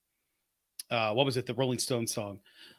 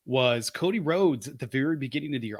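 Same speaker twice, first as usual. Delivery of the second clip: usual speaking voice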